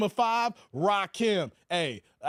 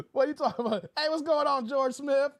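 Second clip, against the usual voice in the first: ordinary talk, nothing marked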